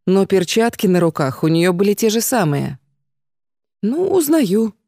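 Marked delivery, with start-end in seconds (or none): 3.8-4.7 annoyed, grudging admission; irritated. Acted. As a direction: snap it out in irritation. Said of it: "ответила она раздражённо".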